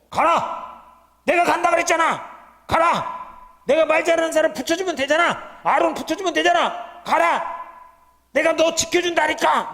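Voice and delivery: loud, high commanding shout; God's command. Acted. God shouting orders at a reluctant Moses.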